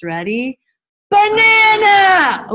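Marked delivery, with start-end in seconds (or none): 1.1-2.5 high, drawn-out shout; loud outside voice. Shown as a demonstration. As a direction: belt it out like calling across a playground. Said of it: "with your level four voice".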